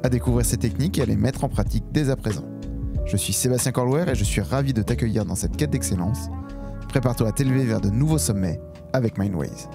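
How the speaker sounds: upbeat host intro over music; podcast intro read. Acted. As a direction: announce it like a show opener.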